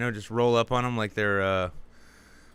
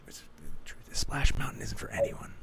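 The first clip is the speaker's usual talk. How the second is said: hushed conspiratorial whisper. Acted. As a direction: lean in and whisper the secret. Confiding a joke aside.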